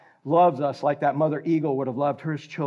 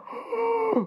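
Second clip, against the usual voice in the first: sharp high gasp of surprise; startled gasp. Pretend. Preacher imagining frogs under the pillow, high gasp. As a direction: gasp as if finding a frog.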